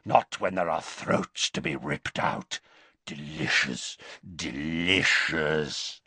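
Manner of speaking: taste each word; relishing werewolf. Acted. Greyback to Dumbledore, savouring the gore.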